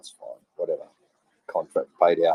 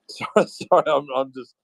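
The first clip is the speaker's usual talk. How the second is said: laughing apology; 6 semitones higher than usual. Real, not acted. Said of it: "Sorry, guys".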